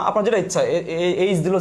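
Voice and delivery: ordinary delivery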